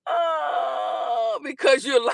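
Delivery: loud call-out breaking into laughter; laughing shout. Real, not acted. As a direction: shout it through a laugh at a liar. Calling out a commenter.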